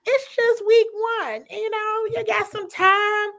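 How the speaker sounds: reassuring quote. Retold or imitated. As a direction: pat them on the back lightly. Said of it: "And I'm like".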